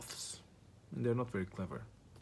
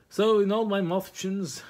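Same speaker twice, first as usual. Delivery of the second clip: hammed-up announcement; 8 semitones higher, 7 dB louder than usual. Loud restart of the story.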